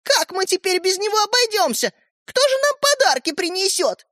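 very high sing-song taunt; mocking. A narrator's reading. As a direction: mimic worry to ridicule him. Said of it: "насмешливо раздалось из-за двери". (насмешливо, притворно причитая)

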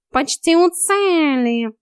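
high, drawn-out call from above; encouraging cat coach. A narrator's reading. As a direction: urge them on teasingly.

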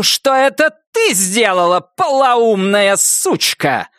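high, slow, venomous outburst; lashing phone insult. Acted. Wilma lashing out at Nettie over the phone, venomous. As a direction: spit the insult down the line. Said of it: "Хлестнул её голос из трубки".

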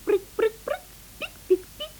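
high little chirping peeps; peeping baby chick. Acted.

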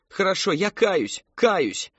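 loud, high-pitched surrender; desperate cry. A narrator's reading. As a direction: cry out slowly, giving in. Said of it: "вскричала Мэри Кэтрин".

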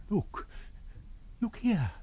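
high, soft hushed call; whispered. A narrator's reading. Old woman pointing out the body, hushed whisper. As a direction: draw their eyes in awe. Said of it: "whispered an old woman".

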